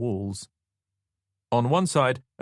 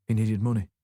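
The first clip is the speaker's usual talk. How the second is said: weary Yorkshire widow, unenthusiastic; flat, grudging Yorkshire voice; Yorkshire accent. A narrator's reading. Grieving widow offering tea, in a Yorkshire accent. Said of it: "She had a solid Yorkshire accent".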